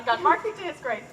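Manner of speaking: normal talking voice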